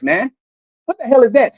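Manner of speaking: ordinary talk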